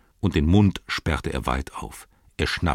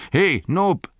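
high, loud summons; calling out; 13 semitones higher, 5 dB louder than usual. Acted. Innkeeper calls his servant from the door, calling out. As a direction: call a servant across the house. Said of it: "rief er".

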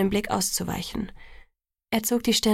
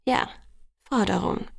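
earnest, falling confirmation; suddenly serious. A narrator's reading. He holds her to the word, serious again. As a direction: confirm it soberly. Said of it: "jetzt auf einmal wieder ernst".

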